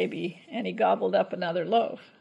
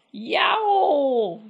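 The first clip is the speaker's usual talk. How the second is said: a sharp, higher, louder yelp of pain; pained shout; 6 semitones higher, 6 dB louder than usual. A narrator's reading. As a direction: yelp as the teeth break. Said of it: "shouted Cuchulainn".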